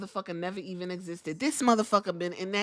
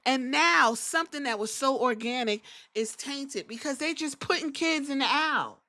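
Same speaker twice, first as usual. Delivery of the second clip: ordinary delivery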